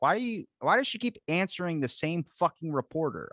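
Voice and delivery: high-pitched, incredulous griping; exasperated complaint. Retold or imitated. Acted complaint at press secretary.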